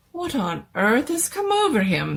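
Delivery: worried, rising alarm; the doctor frets over the din; agitated. Acted. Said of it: "by now looking extremely agitated".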